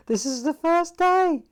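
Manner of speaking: loud, high and drawn-out disbelief; incredulous exclamation. Real, not acted. Storyteller marvelling that this was only day one, incredulous exclamation. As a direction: exclaim it, pitch leaping up.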